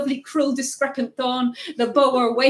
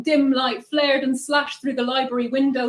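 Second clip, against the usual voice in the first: usual speaking voice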